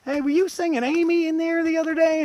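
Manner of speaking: very high-pitched mock voice of the shop woman; put-on high voice quoting the store lady; imitated store lady. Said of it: "she's like".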